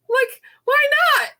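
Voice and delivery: squeaky high playful why not. Retold or imitated. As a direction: pitch way up in giddy disbelief. Giddy retold shrug about entering.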